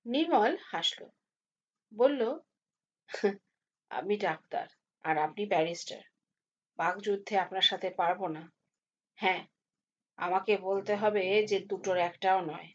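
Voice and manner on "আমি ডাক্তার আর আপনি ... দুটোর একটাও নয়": lightly voiced doctor, lower and softer; doctor conceding politely. Doctor yielding to the barrister in a war of words, politely. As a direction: concede with quiet, low deference.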